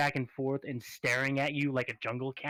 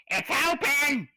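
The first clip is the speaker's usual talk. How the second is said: shopkeeper voice; 10 semitones higher, 4 dB louder, slower than usual. Acted. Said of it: "your god has been practicing voices".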